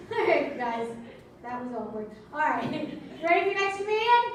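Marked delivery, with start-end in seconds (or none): 3.2-4.2 loud, high, slowed crowd call; hype shout. Acted. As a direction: drag it out big. Host hyping the crowd.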